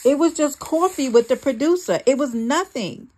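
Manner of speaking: high, loud protest; yelling back. A narrator's reading. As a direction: shout back in self-defense. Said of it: "I yelled back".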